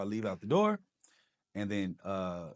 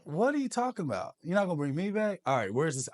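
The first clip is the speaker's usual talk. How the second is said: shocked disbelief; 8 semitones higher, 5 dB louder, faster than usual. Retold or imitated. Realizes he is being cut.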